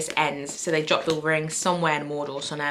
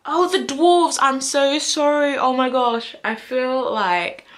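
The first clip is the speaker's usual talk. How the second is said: higher and louder, a sudden flustered oh; embarrassed realization; 8 semitones higher, 6 dB louder, slower than usual. Real, not acted. Vlogger realizing her mix-up to fans, flustered surprise. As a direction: blurt it out as the mistake dawns on you.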